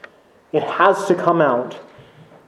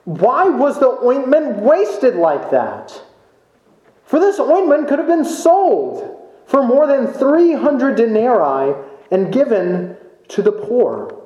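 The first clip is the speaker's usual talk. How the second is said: indignant; 7 semitones higher than usual. Retold or imitated.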